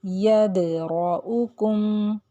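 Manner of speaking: यदरउकुम very slow with द shaken; qalqala on dal. Shown as a demonstration. Teacher repeating the example with a bounce. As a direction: stretch यदरउकुम and rattle the द. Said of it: "हमने दाल को यहाँ हिलाया".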